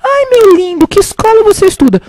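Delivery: high, loud, hammed-up cooing; gushing mother. Shop mother turning to the boy, high mock cooing. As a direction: imitate a mother fussing over someone else's child. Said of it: "ela virou assim".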